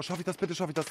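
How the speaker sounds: usual speaking voice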